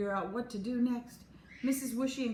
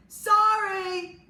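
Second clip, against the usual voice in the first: a much louder, higher shout; yelled; 12 semitones higher, 13 dB louder than usual. A narrator's reading. Max yells sorry in Chloe's face, much louder. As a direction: blast it right in her face. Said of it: "Max yelled in her face".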